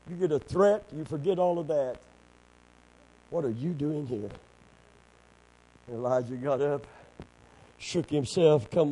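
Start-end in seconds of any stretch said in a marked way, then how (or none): 3.3-4.3 quiet rebuke. Acted. God asking Elijah in the cave, quiet rebuke. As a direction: ask it quietly, without any shout. Same question repeated softly.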